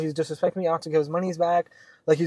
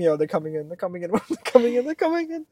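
mock panic building; 3 semitones higher than usual. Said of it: "like, panicking".

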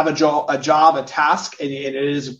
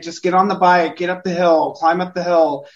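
higher, urging son onward; encouraging father; 4 semitones higher, 4 dB louder than usual. Retold or imitated. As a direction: coax him up the hill. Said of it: "he's like".

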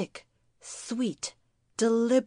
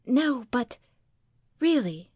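tag; 4 semitones higher, 3 dB louder than usual. Acted. Wife presses husband for reassurance.

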